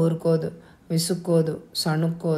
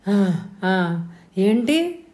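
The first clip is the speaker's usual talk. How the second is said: startled awake; much slower than usual. A narrator's reading. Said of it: "కంగారుగా లేచింది అమ్మ".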